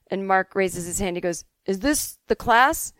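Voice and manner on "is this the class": slow, higher question in a vague put-on accent; lightly voiced kid with an odd accent; baffled pupil, slight unidentifiable accent; Mark's unplaceable accent. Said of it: "he had some accent".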